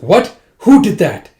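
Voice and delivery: high, loud acted outburst; shocked demand. King hearing of a surrender, shocked shout.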